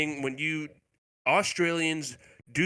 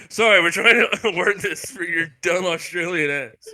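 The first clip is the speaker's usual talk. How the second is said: louder, higher mock condescension; condescending; 6 semitones higher, 6 dB louder than usual. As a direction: talk down to the Australian. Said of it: "He's like".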